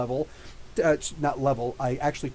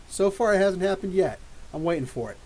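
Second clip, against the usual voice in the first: ordinary delivery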